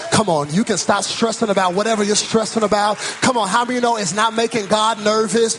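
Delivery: rolling preacher cadence, building to a high peak; full-force preaching. Real, not acted. Preacher telling the church God has all authority, full-force preaching. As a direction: drive each phrase home, climbing steadily.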